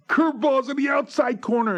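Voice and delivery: high, loud strained reply; imitating a batter's yelled answer; shouted mid-swing. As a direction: call it out while swinging the bat.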